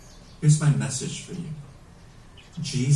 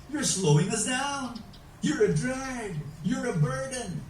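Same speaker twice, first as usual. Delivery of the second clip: higher-pitched, mildly scolding complaint; mock complaint; 9 semitones higher, 4 dB quieter than usual. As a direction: play an impatient complaint, pitched up. Fast group scolding the slow.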